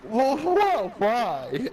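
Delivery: high, drawn-out protest; mock shock. Reacting to the film's Budapest line, mock shock. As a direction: stretch it high in exaggerated disbelief.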